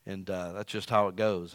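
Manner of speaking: ordinary talk, nothing marked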